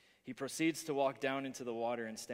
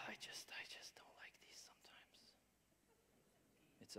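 whisper; 19 dB quieter than usual. Real, not acted. Fumbling with slides aside.

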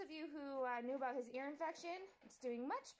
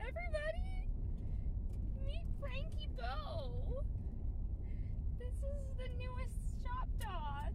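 much higher, slow, drawn-out announcement; excited reveal; 11 semitones higher, slower than usual. Real, not acted. Owner unveiling the new puppy to viewers, high slow excited. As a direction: present the puppy with a big build-up.

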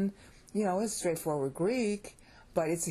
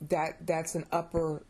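usual speaking voice

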